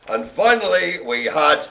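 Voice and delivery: ordinary talk, nothing marked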